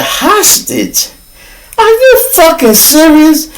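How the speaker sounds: high-pitched acted disbelief; retold indignant astonishment; incredulous. Retold disbelief at being held hostage.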